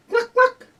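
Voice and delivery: loud, high, short hen clucks; chicken clucking. Acted. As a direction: cluck like a hen laying an egg. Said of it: "look at that chicken".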